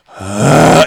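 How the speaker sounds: loud drawn-out groan of hate; long, loud imitated crowd groan; crowd's evil boo. Whole audience turning on the comic, loud groan. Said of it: "it wasn't even like boos".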